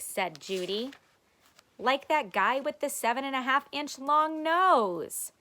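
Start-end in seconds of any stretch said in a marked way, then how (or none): 1.8-5.3 teasing. A narrator's reading. Judy teasing brother.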